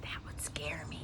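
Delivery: breathy spooky aside; whisper. Real, not acted.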